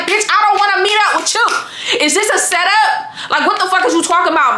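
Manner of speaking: high, laughing angry refusal; disbelieving mock outrage on the phone; incredulous angry retort. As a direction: snap back at her, half laughing. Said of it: "Do you hear how mad I am".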